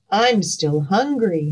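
plain reading voice

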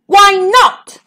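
high, sharp royal yell. A narrator's reading. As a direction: yell it as an affronted king. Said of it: "he yelled".

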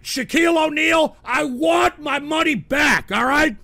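high, emphatic complaint, slowing on the demand; indignant demand. Real, not acted. Host demanding money back for his chair, indignant demand. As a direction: call it out, insistent.

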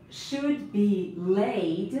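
very slow, drawn-out teacher's model; slow dictation. Shown as a demonstration. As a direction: stretch each word out for learners. Teacher models sentence for learners.

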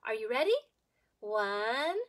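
plain reading voice

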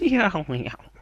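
high meow sliding down; cat meow. Pretend. Gamer meowing to himself mid-game, cat imitation.

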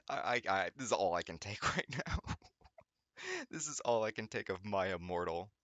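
ordinary talk

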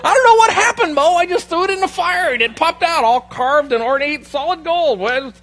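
high, loud, hammed-up innocence; mock-bewildered protest. Aaron feigning amazement to brother Moses, mock-bewildered protest. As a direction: protest wildly that it's a total mystery.

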